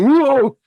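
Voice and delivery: high, loud "whoa" of alarm; startled high cry; alarmed yell. Acted. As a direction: yelp as something flies at you. Villain about to be hit.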